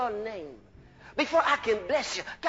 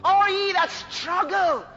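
raised, pleading sermon cry; impassioned preaching; 6 semitones higher, 5 dB louder than usual. Acted. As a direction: cry out the invitation from the pulpit. Preaches Jesus's invitation at full force.

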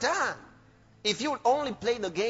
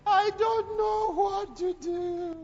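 high wailing mother; 9 semitones higher, slower than usual. Retold or imitated. Altar call mother voiced crying.

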